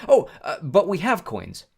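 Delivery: high, loud, startled interjection; sudden objection. A narrator's reading. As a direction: jump in before being turned away. Hurried objection to being turned away.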